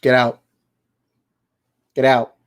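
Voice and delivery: normal talking voice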